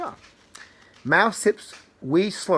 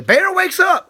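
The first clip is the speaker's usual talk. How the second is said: much higher and louder, sudden alarm; dramatic; 14 semitones higher, 8 dB louder than usual. A narrator's reading. Reading to a child, the bear suddenly waking, dramatic. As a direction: burst out as the bear wakes. Dramatic turn in bedtime story.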